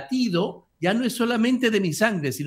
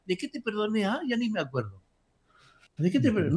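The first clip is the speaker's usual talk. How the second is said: a hint of a dim, forgetful master, softer; mock forgetful master; 4 semitones higher, 4 dB quieter, faster than usual. Preacher mocking forgiving as forgetting, in a softer put-on voice. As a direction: lightly play the lord who forgot the debt, softer. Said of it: "le decía".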